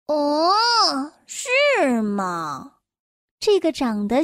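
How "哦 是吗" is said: sad, tearful. Acted. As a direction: sigh it out sadly. Mr. Sad answers, near tears.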